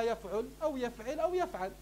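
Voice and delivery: يفعل said as yaf'ul, then yaf'il, then yaf'al; vary the last vowel of يفعل: u, i, a; three vowel patterns. Shown as a demonstration. Teacher listing present-tense patterns, يفعل as yaf'ul, yaf'il, yaf'al. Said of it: "إما مضارعها".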